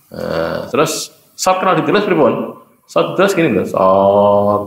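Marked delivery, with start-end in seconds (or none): none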